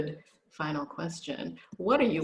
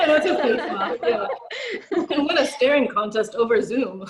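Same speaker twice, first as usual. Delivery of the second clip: laughing; 4 semitones higher, 6 dB louder than usual. Real, not acted. Host laughing along.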